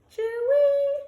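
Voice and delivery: high, loud cheer-wee call; sad bird call. Acted. The caged bird calling to the dog, high and loud. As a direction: chirp it out like a lonely bird. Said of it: "bird buddy's sad song".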